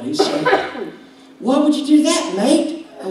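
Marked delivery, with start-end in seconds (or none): 1.4-2.9 mock Australian accent. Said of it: "Why are you talking like that?".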